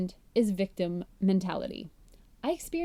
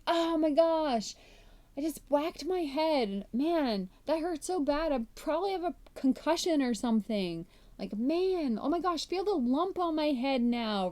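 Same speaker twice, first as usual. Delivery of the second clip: mock whining; 6 semitones higher than usual. Host acting out whining after bumping a cabinet door, high mock whine. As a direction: overplay the pain for sympathy. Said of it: "We get all whiny and complaining".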